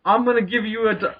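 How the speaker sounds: lightly voiced stern doctor; mock doctor voice. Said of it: "my doctor said to me".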